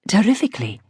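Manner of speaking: a grand lady's dismissive posh English, Persian-tinged; lightly voiced posh Iranian lady, gazing up aloof; posh Iranian accent. A narrator's reading. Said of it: "tremendously posh voice with its just perceptible Iranian R".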